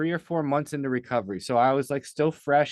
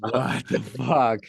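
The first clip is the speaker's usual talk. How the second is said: slow, drawn-out mock shock; stunned disbelief; 3 dB louder, much slower than usual. Caught off guard by being made the speaker, slow stunned reaction. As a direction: drag it out like you can't believe it. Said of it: "And I'm like".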